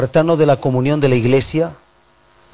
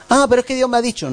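much higher and fast, whiny excuse; mock excuse; 10 semitones higher, faster than usual. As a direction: parody a churchgoer making excuses. Imita la excusa de un fiel. (excusa burlona, aguda y rápida)

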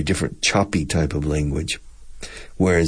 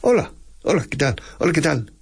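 quick, high Spanish greeting; staccato, abrupt; 9 semitones higher, much faster than usual. Shown as a demonstration. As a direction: fire off short, high-pitched syllables.

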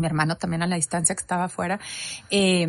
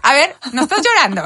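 higher, louder mock check-up; playfully inspect and scold mom; teasing sons; 6 semitones higher, 8 dB louder than usual. Sons checking on tearful mother at a film, loud teasing. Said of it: "con los hombres me da risa".

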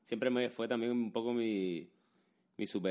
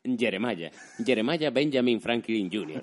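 mock dubbed-American self-introduction; Spanish dubbing accent; 4 dB louder than usual. Dubbed American giving his full name, in Spanish dubbing accent. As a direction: announce the name like a dubbed film character. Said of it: "el acento del doblador español".

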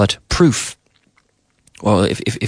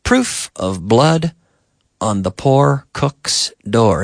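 slow, separated words for learners; slow model sentence; slower than usual. Shown as a demonstration. Teacher models double O sentence for learners, slow and measured. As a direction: say it slowly, pausing midway.

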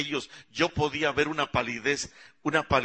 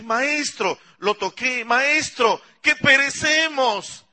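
high, loud cry for help; desperate call; 12 semitones higher, 6 dB louder, slower than usual. Acted. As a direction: shout to wake the master. Calling master in storm. (llamado desesperado, agudo y fuerte)

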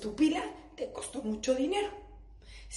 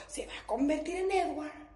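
dreamy whisper; 3 semitones higher, 5 dB quieter than usual. Pretend. Fantasy of husband shining.

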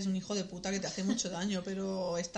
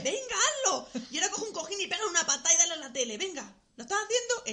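excited coaching; 9 semitones higher, 5 dB louder, faster than usual. Pretend.

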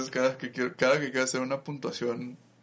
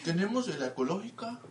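higher, laughing shopkeeper pitch; mock salesman dealer; 7 semitones higher, 4 dB quieter, faster than usual. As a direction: sell it like a friendly store clerk. Imitating dealer offering his stock. (vendedor amable en broma, más agudo)

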